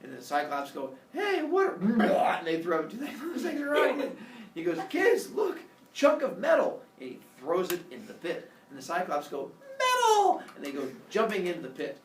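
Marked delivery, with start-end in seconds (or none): 1.1-1.8 high, loud startled question; eager Cyclops. Acted. Cyclops react to the distraction. 4.9-6.8 high, loud bait call; luring excitement. Acted. Father Sky baits them with metal. 9.8-10.4 very high, loud squeal; thrilled Cyclops. Acted. Cyclops thrilled, leap after it.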